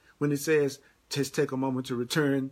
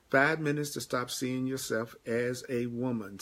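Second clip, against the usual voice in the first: normal talking voice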